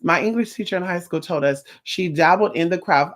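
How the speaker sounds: ordinary talk, nothing marked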